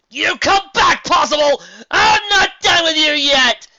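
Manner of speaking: very high, loud screech; cartoon villain's shrieking threat; furious villain yell. A narrator's reading. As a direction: scream after the escaping foe, stretching the end. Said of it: "Dragon yelled".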